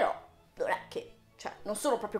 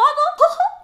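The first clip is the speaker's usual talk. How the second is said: high, loud "oh-oh"; squealed mock exclamation; silly voice whoop; 10 dB louder than usual. Vlogger mocking Disney's question, high silly whoop. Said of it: "Non so perché ho fatto questa voce".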